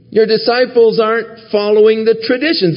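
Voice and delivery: high-pitched mock accusation; accusing. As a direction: voice an indignant challenger, pitched well up. Voicing accusers challenging Jesus.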